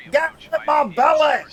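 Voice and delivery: hammed-up Scottish glutton line; Fat Bastard impression. Streamer quotes Fat Bastard in a mock Scottish voice. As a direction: growl it like a greedy ogre. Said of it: "or fat bastard".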